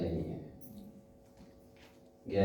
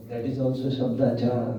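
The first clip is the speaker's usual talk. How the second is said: usual speaking voice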